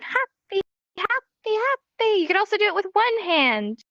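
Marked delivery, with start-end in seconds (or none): 0.0-2.2 higher-pitched cheerful demonstration; happy. Shown as a demonstration. Teacher demonstrates the happy sign, cheerful. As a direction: act out happy with a smiling lift. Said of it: "In real life, you're gonna be smiling".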